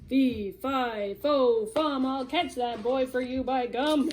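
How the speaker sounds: loud booming giant chant. Acted. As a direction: boom it out like a giant. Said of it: "Chintu boomed".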